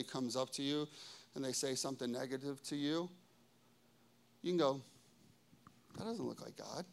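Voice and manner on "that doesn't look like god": very soft, low aside; quiet discernment. Acted. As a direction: murmur it as a private conclusion. Voices private discernment.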